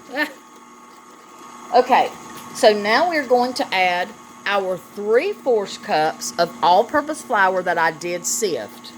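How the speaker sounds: ordinary talk, nothing marked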